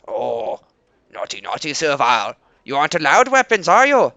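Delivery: mock scolding. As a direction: wag a finger at the naughty servile. Teasing the armed servile.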